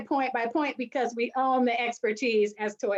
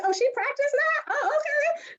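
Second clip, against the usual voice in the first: very high-pitched, squeaky surprise; amazed realization. Acted. Viewer learning the star practiced, high amazed realization. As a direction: play up astonished discovery, way up high.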